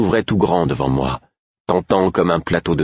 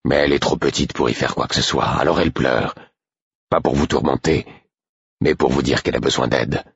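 slightly low, dry explanation; 4 semitones lower than usual. A narrator's reading. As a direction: explain drily, with restraint. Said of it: "d'un ton plus sec". (ton sec et posé)

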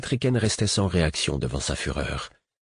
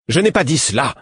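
loud, higher, appalled denial; horror and anger; 7 semitones higher, 6 dB louder than usual. Acted. Queen denying the accusation, horrified anger. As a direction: recoil and snap back in anger. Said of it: "d'un ton où se mêlaient l'horreur et la colère".

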